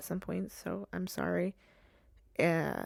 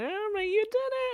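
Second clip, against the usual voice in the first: much higher, nagging accusing voice; mock accuser. Imagined viewer accusing her of skipping posts, high mocking voice. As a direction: play a picky viewer calling her out. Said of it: "someone's like".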